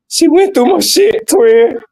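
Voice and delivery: high-pitched imitated blubbering; mock boohoo crying. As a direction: imitate a heartbroken friend getting it out.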